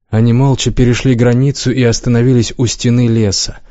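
ordinary delivery, nothing marked

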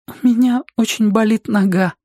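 high, plaintive character voice; plaintive companion. A narrator's reading. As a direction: complain of pain in a high voice. Companion complains of sore leg.

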